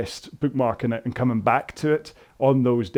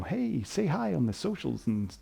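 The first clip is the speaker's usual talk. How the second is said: softer, slow mock influencer sell; mock influencer plug; 8 dB quieter, slower than usual. Vlogger parodying influencer sign-offs, mock salesy plug. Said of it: "I'm not gonna do the whole".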